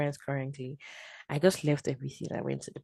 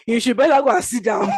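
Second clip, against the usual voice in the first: loud, high brush-off; dismissive retort; 8 semitones higher, 10 dB louder than usual. Retold or imitated. Woman bringing a word from Abba, loud dismissive. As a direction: tell her to sit down, scoffing. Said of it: "I'm like".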